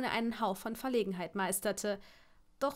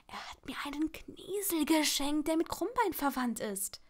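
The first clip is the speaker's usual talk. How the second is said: excited whisper; 4 semitones higher than usual. A narrator's reading. Secret confided on the phone.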